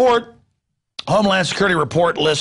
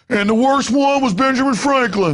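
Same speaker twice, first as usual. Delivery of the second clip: put-on high-pitched country-boy parody; country boy accent; 8 semitones higher than usual. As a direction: lecture in a caricatured country accent. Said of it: "I'm doing a country boy accent".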